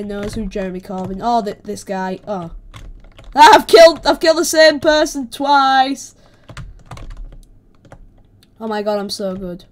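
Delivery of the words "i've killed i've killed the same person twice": loud, excited crowing; raised-voice boast; triumphant shout. Real, not acted. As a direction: shout your victory in disbelief. Crowing over double kill.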